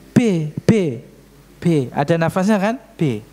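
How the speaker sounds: P with a puff of air; breathy P. Shown as a demonstration. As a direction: pop the air out on each P. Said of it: "kalau P".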